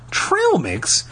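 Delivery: high-pitched, disbelieving echo; incredulous. A narrator's reading. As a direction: repeat it in disbelief, pitch jumping up. Lauren repeats in disbelief.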